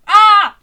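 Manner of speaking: sudden loud high yell; mock high-pitched shout; high-pitched scream. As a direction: imitate his climactic shout, high and very loud. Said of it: "a high-pitched scream".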